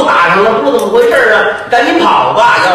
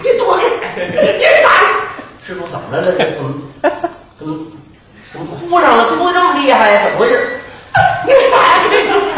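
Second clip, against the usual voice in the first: frantic vendor; 6 semitones higher than usual. Acted. Vendor shouting at the monk to get away. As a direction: shoo him away in a panic.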